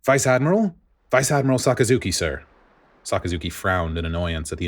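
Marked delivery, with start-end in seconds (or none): 0.0-2.4 put-on a young soldier calling out, pitched higher; eager, higher call at the door; lightly voiced nervous young marine. Young soldier calls into office.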